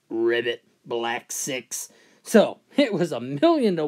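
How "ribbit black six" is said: low, soft croaking frog. Acted. Golfer hears a frog on the green, low soft frog voice. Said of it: "Frog says".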